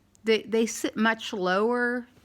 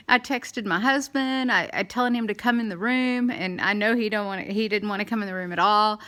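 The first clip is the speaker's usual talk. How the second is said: ordinary talk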